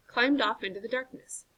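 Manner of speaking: ordinary delivery, nothing marked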